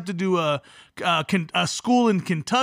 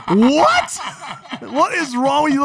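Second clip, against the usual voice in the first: high, loud incredulous scolding; mock shock; 5 semitones higher, 4 dB louder than usual. Uncle reacting to niece's cursing, laughing outrage. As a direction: burst out high, laughter underneath.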